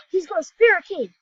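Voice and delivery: sudden thrilled discovery; excited gasp. Real, not acted. Gasping to viewers at his teammate's spirit key, excited.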